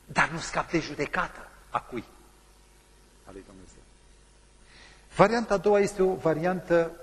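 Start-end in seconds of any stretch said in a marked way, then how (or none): none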